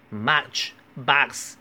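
"much bucks" with open "ah" vowels; Chinese-learner vowel error. Shown as a demonstration. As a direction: say "much bucks" as "mahch, bahks". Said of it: "读成啊". (把much bucks里的“呃”错读成“啊”)

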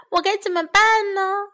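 high, loud wailing dismay; 绝望地喊叫; distressed cry. A narrator's reading. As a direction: lament like a worried mouse. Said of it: "老鼠喊叫了起来".

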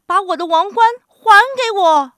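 high, loud outburst; indignant shout in a quarrel; angry demand. A narrator's reading. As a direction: demand it back at full volume. Animals quarrel over the crown.

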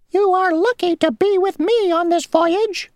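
very high, tiny, self-important insect voice; a little insect bragging; boastful mosquito. Acted. Mosquito boasting to Grasshopper mid-puddle, tiny high voice.

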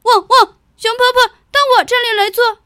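yappy panting puppy. Acted. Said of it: "小狗说".

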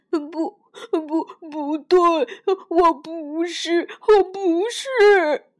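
loud, slowing, desperate no; frantic denial. Acted. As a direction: cry out the denial, dragging the last words. Little dinosaur denies being T-rex.

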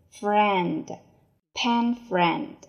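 ordinary delivery

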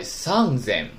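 三千 as Japanese "sanzen", with a voiced z; voiced sound change. Shown as a demonstration. Teacher shows students the special three thousand, voiced z in 三千. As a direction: say 三千 slowly as "sanzen". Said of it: "浊音变了".

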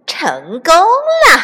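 high, loud, drawn-out crow of triumph; slow, exultant villain cry; gloating weasel. A narrator's reading. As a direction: crow that the trick has worked.

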